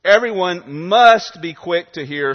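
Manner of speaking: must punched loud, the line slow and deliberate; slow down and hit the word must; preacher driving home must, slow and loud; stress on must. Shown as a demonstration. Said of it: "Put the emphasis where it is".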